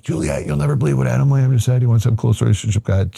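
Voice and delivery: high-pitched mock yell, no real volume; retold excited yell. Friend yelling the news to his wife, retold as a high mock yell. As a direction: call out high and quickening, imitating an excited friend. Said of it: "he's yelling to his wife".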